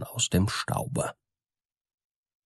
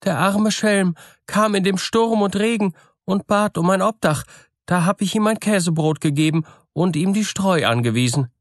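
high-pitched wife's voice; miller's wife explaining; 11 semitones higher than usual. Acted. Wife tells her husband about the poor guest, high explaining voice. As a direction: explain the stranger away in a high wifely voice.

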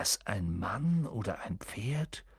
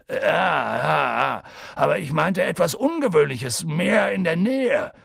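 raised, exasperated insistence; impatient; 6 semitones higher, 5 dB louder than usual. A narrator's reading. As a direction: press the point loudly, losing patience. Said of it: "unter dem ungeduldigen Blick Hagrids". (laut und ungeduldig)

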